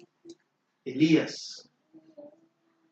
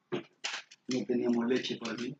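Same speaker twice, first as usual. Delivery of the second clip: low, soft, choked-up retelling; almost crying; 4 semitones lower, 7 dB quieter than usual. Retold or imitated. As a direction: quote it softly, as if close to tears. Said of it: "le dijo el marido casi llorando". (casi llorando, en voz baja)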